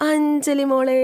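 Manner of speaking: high, drawn-out singsong call; acted gushing entrance call. Character arrives calling Anjali.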